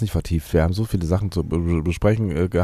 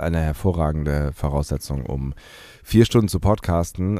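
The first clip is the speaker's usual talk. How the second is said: ordinary talk, nothing marked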